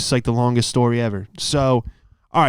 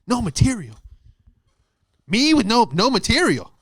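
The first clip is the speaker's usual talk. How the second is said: much higher, sneering parody of the verdict; mocking the judge; 9 semitones higher than usual. Comic mocking the judge in his head, squeaky parody. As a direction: mimic his dismissal in a squeaky mock voice. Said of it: "in my head like".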